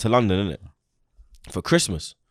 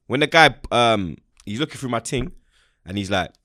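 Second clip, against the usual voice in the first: ordinary talk, nothing marked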